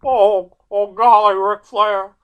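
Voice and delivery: put-on high, loud, strained old cowboy voice; hammed-up old wrestler begging for a title shot; Terry Funk impression. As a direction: squawk it up high like an excitable old Texan. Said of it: "Terry Funk's in the ring going".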